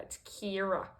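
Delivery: quiet imitation of an Australian "Kira"; imitated Australian accent. Imitating how the actress always says it, soft mock Australian accent. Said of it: "the actress always says it like that".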